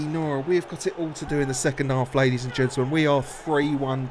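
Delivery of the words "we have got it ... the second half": ordinary delivery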